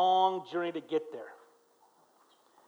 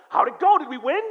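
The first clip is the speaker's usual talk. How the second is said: higher, louder eager retold question; 6 semitones higher, 7 dB louder than usual. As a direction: act out the hopeful friend asking. Eager question to returning friends.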